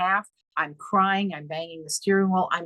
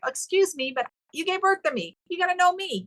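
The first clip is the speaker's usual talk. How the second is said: mock indignant protest; 12 semitones higher than usual. Daughter protesting to her mother, mock indignation. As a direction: demand she remember you, half laughing. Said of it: "it was like".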